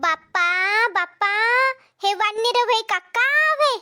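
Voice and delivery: ordinary talk, nothing marked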